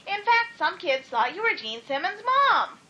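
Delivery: high, cheeky jab; teasing. A narrator's reading. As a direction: tease her with glee. Teasing her mother.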